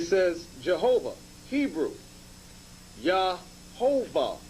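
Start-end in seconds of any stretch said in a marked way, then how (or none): 3.0-4.4 Yahovah, read slowly and carefully, each syllable drawn out; reading a word. Shown as a demonstration. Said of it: "or more correctly".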